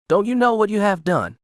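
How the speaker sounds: high, upset demand without full shouting; thick with emotion. A narrator's reading. Avarice confronting Kira over what was done, emotional. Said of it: "her voice thick with emotion".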